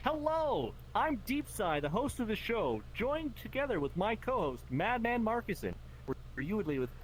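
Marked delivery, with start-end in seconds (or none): 0.0-5.7 higher, bright host greeting voice; show host intro. Retold or imitated. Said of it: "the one who addresses the clients first is".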